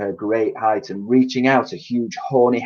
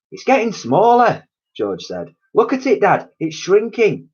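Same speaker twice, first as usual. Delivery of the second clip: high, raised alarmed voice; alarmed boy; 10 semitones higher, 4 dB louder than usual. A narrator's reading. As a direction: point out the shrinking hen. George alarmed.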